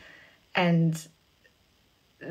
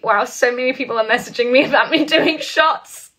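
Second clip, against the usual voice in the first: laughing; 6 semitones higher, 9 dB louder than usual. Real, not acted. Reacting to fan messages.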